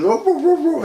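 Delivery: high-pitched dog-like woofs; mock barking. Storyteller barking at the intruder, high mock woofs. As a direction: imitate barking in quick high bursts. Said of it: "I'm, like, barking".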